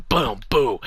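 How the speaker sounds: voiced playful boom boom; mock punch sounds. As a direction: punch noises out loud.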